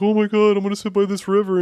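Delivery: very high, fast, whiny mock lament; mock self-pitying gazelle. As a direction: ham up a wallowing gazelle. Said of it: "and be like".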